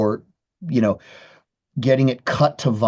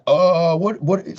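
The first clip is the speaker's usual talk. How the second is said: higher, baffled double take; mock confusion; 5 semitones higher, slower than usual. Guitarist recalling his reaction to the drummer, mock surprised. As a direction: play the startled "what?" a touch hammed-up.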